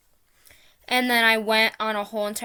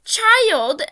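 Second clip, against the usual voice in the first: building to a loud, much higher furious yell; retold outraged rant at a gamer, getting louder; angry rant; 8 semitones higher, 8 dB louder than usual. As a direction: lecture them in rising outrage until you're shouting. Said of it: "started going on a whole rant".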